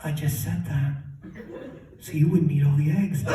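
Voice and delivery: low, dry mother voice; matter-of-fact mom on the phone; mom's deadpan confession. Acted. Mom confessing her lie by phone.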